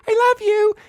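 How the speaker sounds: very high, squeaky affectionate sign-off; car bush. Retold or imitated. Talking bush says goodbye to son, sweet squeaky voice. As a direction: say it in a silly, loving squeak. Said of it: "the car bush always says".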